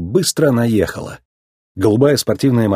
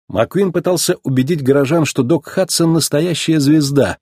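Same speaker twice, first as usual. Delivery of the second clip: plain reading voice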